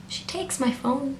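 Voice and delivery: hushed, slow, halting disbelief; stunned disbelief. Retold or imitated. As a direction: say it softly and slowly, as if stunned. Stunned repeat before screaming it.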